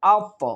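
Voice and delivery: আত with a bounced release on the final heavy t; qalqalah push. Shown as a demonstration. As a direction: push off the last ত of আত. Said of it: "একটু ধাক্কা দেব আমরা".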